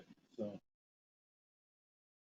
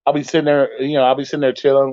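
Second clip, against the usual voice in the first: ordinary talk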